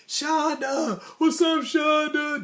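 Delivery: put-on high, drawn-out call to a girl; hammed-up playground greeting, stretched long; imitating kids calling out. Kids on the playground calling out to Shonda, high and slow.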